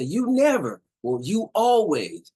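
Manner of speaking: high, loud, stretched accusation; acted exasperated complaint; accusing. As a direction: hurl the blame, leaning on the key word. Quotes partners' sweeping accusations.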